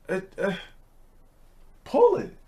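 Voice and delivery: put-on squeaky high child voice, hesitant; a nervous little boy stalling; kid voice. Kid trying to obey.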